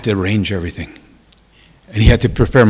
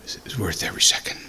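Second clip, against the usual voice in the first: near-whispered, quicker and much softer; hushed repeat; 5 semitones lower, 12 dB quieter, faster than usual. Real, not acted. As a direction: say it almost to yourself, barely voiced. Softer repeat, overcome with emotion.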